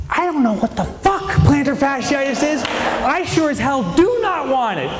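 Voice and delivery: ordinary delivery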